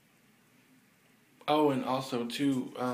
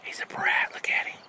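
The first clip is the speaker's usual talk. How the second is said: whispering; 6 dB louder than usual. Real, not acted. Aside about nephew.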